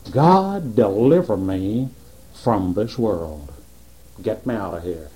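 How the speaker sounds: sinking, hushed cry for rescue; mock desperate prayer. Preacher voicing a believer begging God for deliverance, low and hushed.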